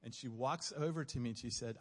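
ordinary talk

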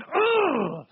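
high-pitched exasperated "ugh" snarl; snarling groan. Acted. Preacher voicing a frustrated soul inside, snarling groan. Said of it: "It's just snarling".

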